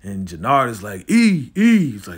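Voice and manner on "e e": high loud call to a fallen friend. Retold or imitated. Jannard calls out to the wounded Elijah. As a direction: shout his name, urgent.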